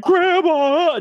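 high, loud wailing call of grandma; a scared child calling out through sobs; sobbing call. Retold or imitated. Scared child sobbing for grandma in a dark house. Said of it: "just sobbing".

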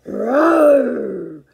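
big loud growling roar; bulldog roar. Acted. Bulldog roaring at Petey, loud growl. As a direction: roar right in his face. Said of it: "growled in Petey's face".

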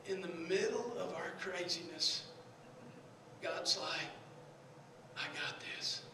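soft, low, with long pauses; gentle, low-pitched preaching; hushed sermon close. Real, not acted. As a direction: bring it down to a quiet, prayerful murmur.

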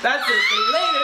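very high, squealing laughter through the words; delighted, laughing squeal; cracking up. Real, not acted. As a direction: shriek it out laughing. Cracking up at the impression.

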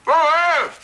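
talking with a tube in the mouth; mouth full. Acted. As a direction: speak around a mouthful. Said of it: "talk with your mouth full".

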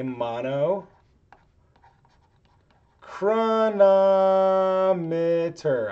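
drawn-out, very slow word; saying it slowly. Real, not acted. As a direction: stretch the word out syllable by syllable. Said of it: "I still have to say that slow".

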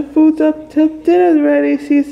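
sing-song call. Real, not acted. Calling a pet to dinner.

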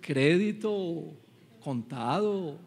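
slow, drawn-out words; salesman listing options. Acted. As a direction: stretch each option out like a patient salesman. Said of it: "el asesor le decía". (vendedor que alarga cada opción lentamente)

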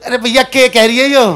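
usual speaking voice

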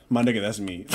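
ordinary delivery

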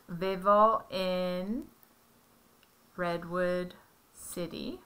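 "Vivo en Redwood City" said slowly and deliberately; slow it down for the learner; slow model sentence. Shown as a demonstration. Parent models the I form slowly. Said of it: "So you would say".

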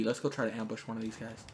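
normal talking voice

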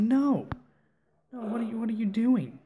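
high-pitched retold objection; alarmed protest. Retold fending off a pushy woman.

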